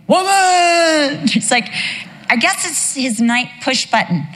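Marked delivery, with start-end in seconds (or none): 0.1-1.3 high, loud grumpy yell of woman; husband's sleepy bark. Retold or imitated. Husband woken by a shove, grumpy yell. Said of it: "doing this again".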